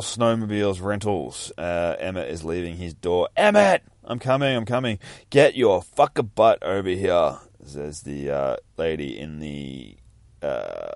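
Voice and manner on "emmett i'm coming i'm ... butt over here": higher, louder call across the yard; yelling. Acted. Lady in puffy jacket calling Emmett, yelling. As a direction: holler at him to hurry. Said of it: "What's all the yelling for?".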